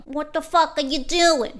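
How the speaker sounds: Brooklyn dad shouting; Brooklyn accent. Retold or imitated. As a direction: give it a Brooklyn bark. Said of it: "he's from Brooklyn".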